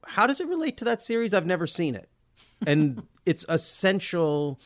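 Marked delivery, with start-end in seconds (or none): 0.1-2.1 higher-pitched imitation of clueless puzzlement; mock confusion, quick at first; baffled viewer. Podcaster imitating viewers unfamiliar with the series, baffled.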